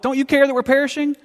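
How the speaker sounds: high-pitched, alarmed plea; mock panicked disciples. Disciples waking Jesus in the storm, mock panic. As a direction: wail it like a panicked disciple, half in jest. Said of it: "they woke him up".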